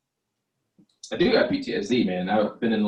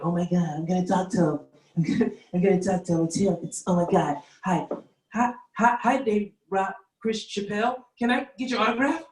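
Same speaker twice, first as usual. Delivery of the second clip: high, fast, gushing fan voice; excited fan rushing up to the comedian for an autograph, high and breathless; starstruck fan; 6 semitones higher than usual. Retold or imitated. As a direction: gush like a starstruck fan spotting a celebrity. Fans imitated meeting him.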